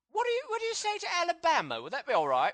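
lightly put-on camp British voice, bouncy and excited; hamming up a pop star pitching a move. Planning a move to Alabama, a mock pop star voice. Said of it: "these are the worst impressions ever".

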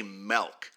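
"milk" with an E, said MELK. Shown as a demonstration. Host showing a hated pronunciation, "milk" said MELK. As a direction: swap the I in "milk" for an E. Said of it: "I can't say milk".

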